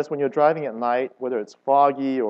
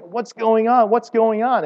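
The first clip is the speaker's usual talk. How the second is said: high, loud panicked cry; frantic, high-pitched plea; 10 semitones higher, 6 dB louder than usual. Retold or imitated. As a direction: cry it out like passengers in terror. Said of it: "was crying out".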